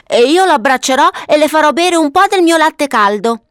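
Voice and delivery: put-on high, eager little-boy voice; enthusiastic child. Papavero promising to hug the snow girl, high and enthusiastic. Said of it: "approvò entusiasta Papavero".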